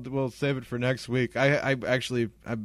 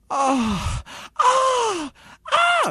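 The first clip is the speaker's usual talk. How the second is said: loud, high drawn-out moans; mock moaning; 9 dB louder, much slower than usual. Storyteller imitating moans from the basement, mock moaning. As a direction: moan loudly and high, hamming it up. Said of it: "it is the sound of moaning".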